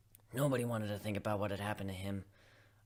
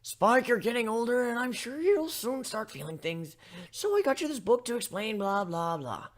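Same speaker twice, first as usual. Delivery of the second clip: high, prim lecturing voice; mimicking Twilight's lecture; 10 semitones higher, 5 dB louder than usual. Spike mocking Twilight handing him a book, high and prim. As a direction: parrot her preachy tone.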